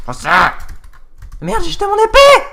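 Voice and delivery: sudden high panicked yelp; panicking gamer. Real, not acted. Minecraft fight, dropped sword.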